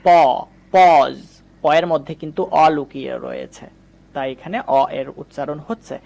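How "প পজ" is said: "প, পজ": first "paw" alone, then the full word loud; teacher breaking down "পজ" to show the "অ" vowel; sounding out. Shown as a demonstration. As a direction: isolate "প", pause, then stretch "পজ". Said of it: "অ এর মতো উচ্চারণ করছি".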